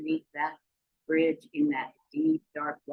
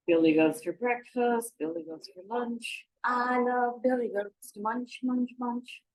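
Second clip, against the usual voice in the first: troll musing on lunch; 6 semitones higher than usual. Acted. Troll muses on eating goats.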